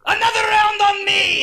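high, loud celebratory shout; rowdy tavern yell. Acted. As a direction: holler it to the whole bar. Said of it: "everybody yells even louder this time".

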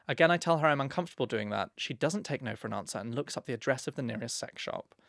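usual speaking voice

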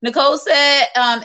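normal talking voice

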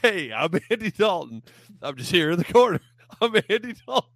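high, loud laughing caricature; squeaky laughing self-announcement; Andy Dalton impression. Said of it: "We had to say".

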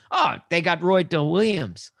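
"Dell" swallowed, "Roy Dell Williams" run together; mumbled Dell. Shown as a demonstration. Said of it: "you'd have to say it like that".

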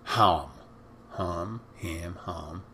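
"ham" repeated with varying vowels, from HAHM to HAM; trying out ham. Shown as a demonstration. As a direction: test the vowel in "ham", word by word. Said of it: "could have been the way it said ham".

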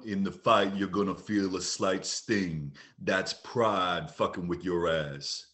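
low, slow, menacing mob boss; low, slow gangster threat; Ving Rhames. Pretend. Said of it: "you want me to be Ving Rhames?".

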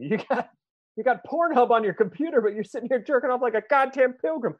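hammed-up angry parent; mock scolding dad. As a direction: chew out the kid like a dad. Mock scolding a teenage son.

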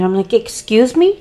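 usual speaking voice

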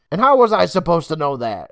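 indignant comeback; defensive retort. A narrator's reading. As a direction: snap back, embarrassed. Embarrassed Emma retorts.

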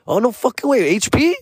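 surprised fan voice, high and bright; retold excitement. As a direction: act amazed he's a local.

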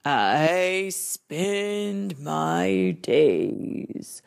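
very slow, calm plow horse. Acted. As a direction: drawl it out like a big horse. Plow horse keeps talking.

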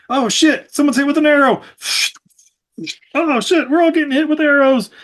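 mock panic. Characters panicking under arrow fire.

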